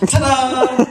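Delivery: high, drawn-out triumphant ta-da; yelled ta-da. Acted. Comedian rescuing a terrible make-out, yelled reveal. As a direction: belt out a big showman's ta-da.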